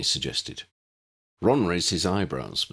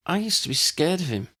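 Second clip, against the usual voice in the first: admitting; 11 semitones higher than usual. A narrator's reading. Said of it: "Lydia admitted".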